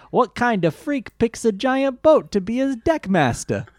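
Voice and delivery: high, sneering disgust; disgusted Joey. Retold or imitated. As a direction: sneer it like a perverse act. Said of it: "He says it in a weird way".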